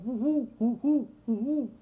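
a string of falling owl hoots; owl hooting. Retold or imitated. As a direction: hoot like an owl on the roof. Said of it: "and it's going".

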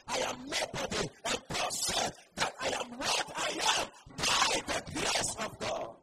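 rising, fervent exhortation; full-force preaching. Acted. Preacher urging the church to boast in God, fervent. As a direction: build it up and drive it home.

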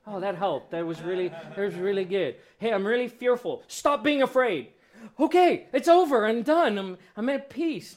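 sarcastic, rising to a near shout of relief; mock relief. Mock instant cure, mocking the anxious listener, hammed up and loud. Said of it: "you're like".

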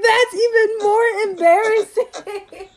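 loud, high wail breaking into laughter; laughing mortification. Retold or imitated. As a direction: cry it out mortified, stretched and laughing. Mortified reply, laughing at herself.

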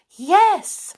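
high, loud fairy cry; excited cry. A narrator's reading. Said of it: "cried the fairies".

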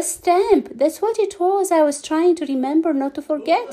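high eager rush of relief; relieved remembering. A narrator's reading. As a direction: blurt it out, pleased. Forgetful man suddenly remembering.

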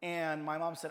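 normal talking voice

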